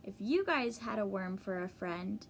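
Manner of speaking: usual speaking voice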